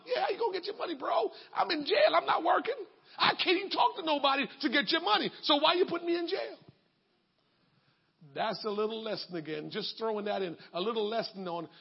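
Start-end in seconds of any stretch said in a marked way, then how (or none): 0.1-6.6 higher, pleading complaint; jailed debtor protesting to his creditor; whiny jailed debtor. Retold or imitated. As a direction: whine like the man locked up.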